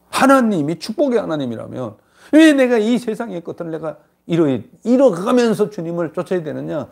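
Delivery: high, indignant mock objection; indignant protest. Preacher acting out people who question following the Lord, indignant protest.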